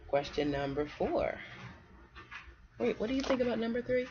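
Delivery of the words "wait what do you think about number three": plain reading voice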